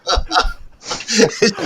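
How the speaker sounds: voiceless high giggle; a breathy airy laugh. Real, not acted. Guest giggling while telling interviewer the story, airy laugh.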